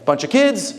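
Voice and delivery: high, eager woman's voice inviting him in; kind widow. Retold or imitated. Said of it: "she's like".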